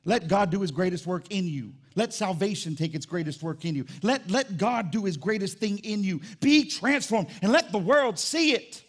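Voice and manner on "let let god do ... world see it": slow, rising pulpit exhortation; building preaching. Real, not acted. Preacher urging church to be transformed, building force. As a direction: build to a high, loud charge.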